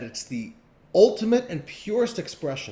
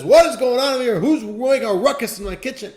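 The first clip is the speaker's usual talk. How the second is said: loud, high outraged yell; who dares make a ruckus in my kitchen; angry chef; 9 semitones higher, 10 dB louder than usual. Acted. Chef yelling at intruders in his kitchen, loud and high. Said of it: "And the chef says".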